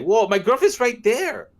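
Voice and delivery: high, loud "whoa"; startled protest. Retold or imitated. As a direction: recoil in a high voice. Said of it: "and I'm like".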